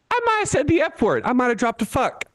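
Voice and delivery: high, innocent voice; sheepish confession. Real, not acted.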